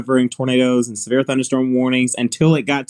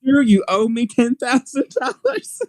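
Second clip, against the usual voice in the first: high-pitched joking protest; mock outrage; 12 semitones higher than usual. Presenter teasing Andrew after the warning came, mock outrage. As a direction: demand it in a much higher voice. Acted mock complaint to colleague.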